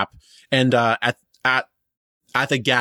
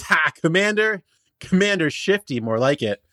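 mocking; 7 semitones higher than usual. Benji mocks the guard.

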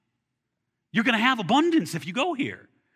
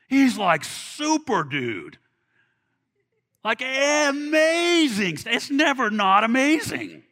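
slow, drawn-out and louder; building excitement; 4 semitones higher, 6 dB louder, much slower than usual. Real, not acted. Pastor marveling at God's deeds, slow and swelling. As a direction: stretch each word with rising awe.

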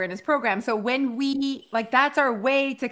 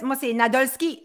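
French. Shown as a demonstration. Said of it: "Je suis française".

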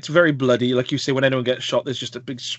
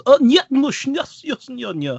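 hammed-up Russian accent, higher and louder, mocking the actor; 12 semitones higher, 4 dB louder, slower than usual. Mimicking Gary Oldman's film villain in a hammy Russian accent. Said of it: "If Gary Oldman is putting on a Russian accent".

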